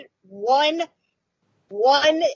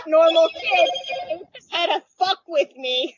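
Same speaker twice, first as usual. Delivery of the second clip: usual speaking voice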